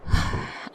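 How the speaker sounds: short, airy sigh; apologetic sigh. Acted. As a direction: sigh before making a promise.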